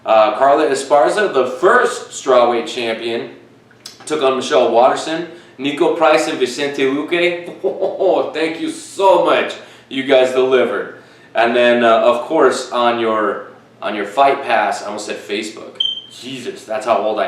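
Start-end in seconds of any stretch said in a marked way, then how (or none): 5.6-11.0 higher-pitched, laughing gratitude; delighted gushing. Real, not acted. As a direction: gush with a laugh over a thrilling fight. Gushing over a favourite fight.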